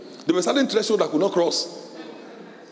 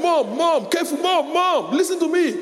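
put-on pleading child; 13 semitones higher, slower than usual. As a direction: cry out to mom to listen, slowly. Preacher acts child pleading mother.

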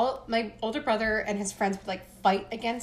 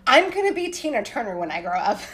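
put-on loud declaration at a small child's pitch; retold scream; 3 semitones higher, 7 dB louder than usual. A four-year-old declaring her dream to the class, loud. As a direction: shout it proudly like a tiny kid. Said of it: "I screamed it".

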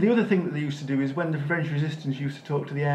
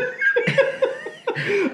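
laughing. Real, not acted.

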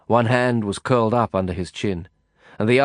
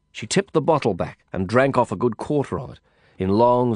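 ordinary delivery

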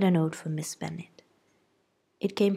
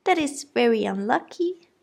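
high-pitched fretting mother; flustered wife defending her girls; fussy mother; 6 semitones higher, 5 dB louder than usual. A narrator's reading. Mrs Bennet voiced.

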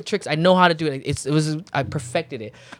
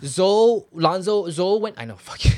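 ordinary talk

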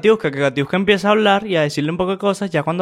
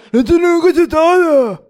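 high, loud yell imitating the cameraman; mimicked cameraman yell; 12 semitones higher, 9 dB louder than usual. Commentator mimicking the cameraman behind the fight, loud high yell. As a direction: belt it out like the rowdy cameraman. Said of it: "Aquí donde parece traje de camarógrafo".

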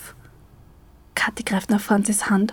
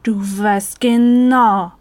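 very slow and louder, near a shout; almost shouting; 5 dB louder, much slower than usual. A narrator's reading. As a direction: bite out each word, close to yelling. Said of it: "Kathi schreit schon fast".

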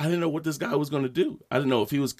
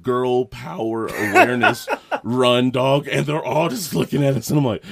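ordinary delivery

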